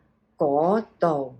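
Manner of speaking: teacher spelling out the tones of 嗰度 slowly; slow tone demo. Shown as a demonstration. Teacher shows students the tones, slow careful demo. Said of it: "You'd be saying the mid rising".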